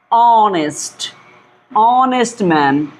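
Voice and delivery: ऑनेस्ट very slow, opening on a long "aw"; silent-h pronunciation demo. Shown as a demonstration. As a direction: stretch ऑनेस्ट into "awww-nest", no h. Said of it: "यहाँ पर एच नहीं पढ़ा जा रहा".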